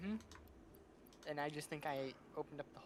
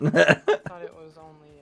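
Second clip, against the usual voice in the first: laughing; 25 dB louder, slower than usual. Real, not acted. Laughing after stinger jump.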